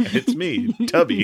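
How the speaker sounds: a friend joking as the pushy Tubby; mock Tubby greeting. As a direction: squeak out a cheery Tubby hello. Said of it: "And you're like".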